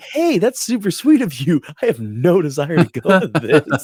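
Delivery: fake-sweet thanks, deadpan no; mock polite refusal. Husband replaying his polite refusal to his wife, mock sweet. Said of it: "And I'm like".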